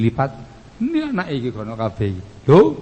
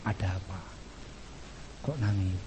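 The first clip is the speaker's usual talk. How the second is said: very soft, low, gentle voice; hushed, soothing questions; tender husband asking; 6 semitones lower, 14 dB quieter than usual. Acted. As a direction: ask softly, as if comforting a crying wife. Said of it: "baru kamu tanya".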